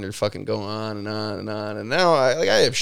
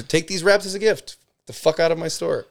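high, sarcastic mock send-off; 8 semitones higher, 3 dB louder than usual. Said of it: "I gave her".

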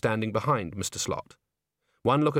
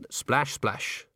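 ordinary delivery

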